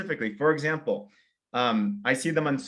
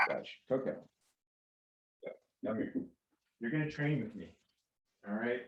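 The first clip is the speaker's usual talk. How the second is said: hushed, half-whispered words to a dog; soft pet talk; 2 semitones higher, 11 dB quieter than usual. Real, not acted. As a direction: coax the puppy softly. Talking to her little dog.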